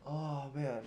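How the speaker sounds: strained groan mid-stretch; groaning while stretching. Real, not acted. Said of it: "Sorry, I'm over here stretching".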